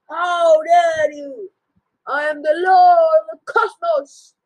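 high, slow, loud furious shout; a fallen king roaring defiance; furious captive. Acted. Captured father roaring at his sons, furious.

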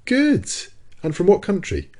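very high-pitched, pointed retort; high, ironic questioning; challenging. A narrator's reading. Professor challenging Ned's hasty verdict.

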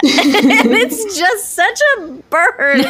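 laughing through the words; cracking up while telling it; high giggly laugh-talk. Real, not acted. Laughing at the burn.

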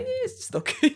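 very high, noncommittal reply; imitating stubborn so-so approval; underselling. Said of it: "always undersell".

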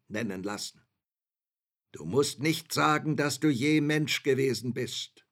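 grand lordly voice. Acted. Shadow boasts to the learned man.

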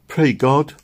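understated tearful plea; crying prayer. A narrator's reading. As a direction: beg God in tears. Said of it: "she cried".

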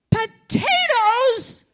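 high, loud, drawn-out yell; angry yell. Retold or imitated. Shouting at God over the potatoes, furious drawn-out yell. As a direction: scream the word out in outrage. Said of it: "I yelled".

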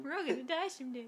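lightly voiced morbid muffin. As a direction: rattle it off as a doomed cartoon muffin. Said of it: "just like the morbid muffin".

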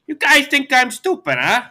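high, loud put-on taunt; mock villain taunt. [name] taunts the heroes for underestimating him, mock villain taunt.

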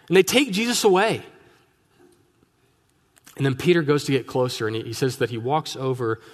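usual speaking voice

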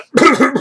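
sudden loud cough; coughing fit. Real, not acted. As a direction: hack out one harsh cough. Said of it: "got a frog in my throat".

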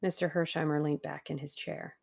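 usual speaking voice

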